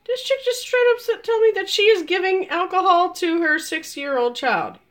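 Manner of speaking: high, squeaky incredulous voice; stunned disbelief. Retold or imitated. Acts out her stunned inner reaction.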